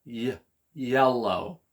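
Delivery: first sound "Y-" alone, then a slow, low "yellow". Shown as a demonstration. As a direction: isolate the start "Y-", then stretch "yellow". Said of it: "Can you hear the word?".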